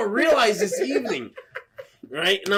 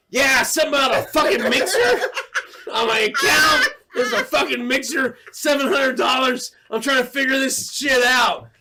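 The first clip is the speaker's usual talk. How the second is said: raised, high-pitched complaint; irate caller; 7 semitones higher, 4 dB louder than usual. Retold or imitated. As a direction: complain loudly into the phone, exasperated. Acted angry phone complaint.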